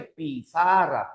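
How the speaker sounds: "Pizarra" slowly split as pi-za-rra; syllable by syllable. Shown as a demonstration. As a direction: sound out "Pizarra" slowly, one syllable at a time. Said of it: "Analiza sílaba por sílaba". ("Pizarra" lento, silabeado pi-za-rra)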